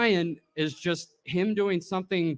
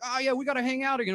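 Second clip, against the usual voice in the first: higher, fast dopey promise; goofy Ryan voice; 9 semitones higher, faster than usual. Retold or imitated. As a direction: toss it off like a drunk pal. Ryan's reply imitated.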